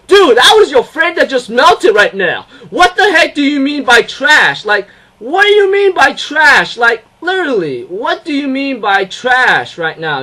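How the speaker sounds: loud, high, stretched-out disbelief; indignant outburst. Retold or imitated. Viewer scolding a character who said trash, indignant. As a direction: tell the character off, outraged. Acted indignant retort to Kazumi.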